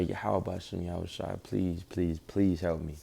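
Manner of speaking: low, subdued pleading prayer. Retold or imitated. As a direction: retell a hushed plea for help, pitched low. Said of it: "is pray".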